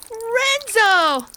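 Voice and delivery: high, loud cry of the name; exasperated shout. Acted. Girl shouting as her dog interrupts again. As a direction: yell out in frustration.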